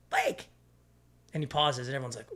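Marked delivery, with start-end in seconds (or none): 0.1-0.5 very high, drawn-out name call; coach calling out. Retold or imitated. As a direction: call the star's name like a coach about to explode. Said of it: "and he goes".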